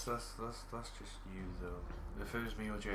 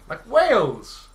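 excited shout; 11 semitones higher, 16 dB louder, much slower than usual. Real, not acted. Joking shout about unknown countries.

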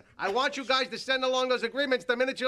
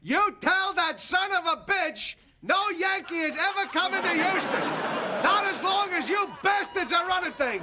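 repeats it higher and louder so the far end hears; shouting louder; 7 semitones higher than usual. Acted. Asked to speak up, George to the Astros, shouting louder. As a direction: belt it up the phone line. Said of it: "now speak up, George".

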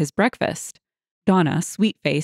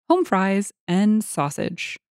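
plain reading voice

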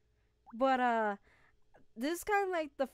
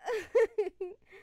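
relieved laughter; 7 semitones higher, 6 dB louder than usual. Real, not acted. Laughing after near loss.

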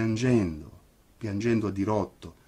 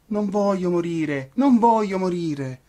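high, slow, frightened cry of a puppet; panicked puppet howling; desperate wailing; 10 semitones higher, 5 dB louder than usual. A narrator's reading. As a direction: beg for your life, stretching each word. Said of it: "per ogni verso urlava".

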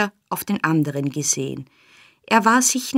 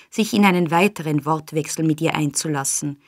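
ordinary delivery

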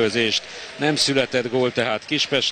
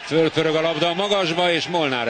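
excited commentary; 6 semitones higher than usual. Real, not acted. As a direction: jump up in pitch on the name. Live chance in the box.

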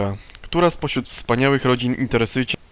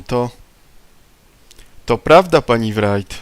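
insistent outburst; 5 dB louder than usual. Acted. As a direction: push back hard on prawda. Tommy insists against angry woman.

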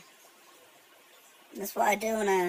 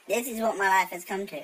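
ordinary talk, nothing marked

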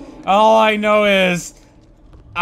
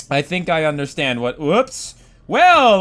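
usual speaking voice

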